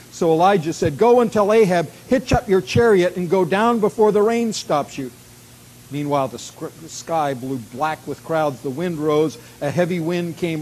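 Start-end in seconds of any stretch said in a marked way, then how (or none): 1.0-5.1 loud, high-pitched prophetic order; urgent command. Acted. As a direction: send the messenger off with a forceful call. Elijah sends urgent message to Ahab.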